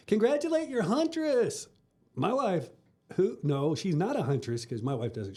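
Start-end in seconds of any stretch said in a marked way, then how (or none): 0.1-1.7 much higher, giddy cheer; a light impression of the tipsy wives. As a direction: sing it out like a tipsy proud friend. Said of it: "they're like".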